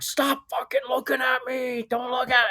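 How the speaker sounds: high, strained mock shout, held back in volume; imitated screaming. Drunk buddy screaming at strangers, retold as high mock shouting. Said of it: "I start hearing some screaming".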